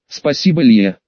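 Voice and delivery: plain reading voice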